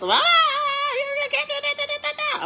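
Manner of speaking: shrill mimicry of foreign-sounding babble; high-pitched yelling. Retelling the herbalist yelling at her, high-pitched mock scolding. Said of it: "really high-pitched".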